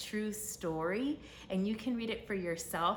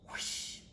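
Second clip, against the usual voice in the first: soft breathy whoosh of air; whoosh sound; 4 dB quieter than usual. Acted. Narrator makes the angel's arrival noise, soft whoosh. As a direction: blow out a quick whooshing breath.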